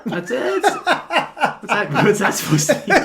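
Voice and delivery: laughing it off, caught out. Real, not acted. Teased about dad's music taste.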